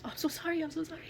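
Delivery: high-pitched pleading apology; imitating a distraught driver; retold frantic apology. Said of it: "and he goes".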